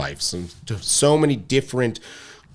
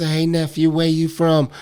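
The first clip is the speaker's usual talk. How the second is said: higher-pitched, friendly rapper impression; 5 semitones higher than usual. Rapper asks his nephew where he's from, light impression. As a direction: lightly imitate a laid-back rapper uncle. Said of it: "I love the [name] impression".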